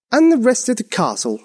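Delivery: high-pitched queenly order. A narrator's reading. Queen demands fires throughout the castle, ordering. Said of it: "ordered the Queen".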